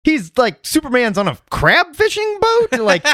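high, laughing disbelief; incredulous reaction. Retold or imitated. Fans reacting to the first trailer, mock disbelief. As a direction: react like it makes no sense. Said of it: "everybody went".

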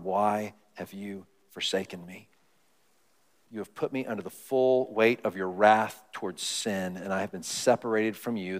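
a hushed, low lament of abandonment. Retold or imitated. Jesus crying out to the Father on the cross, low hushed anguish. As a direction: grieve it low and quiet. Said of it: "when he cries out".

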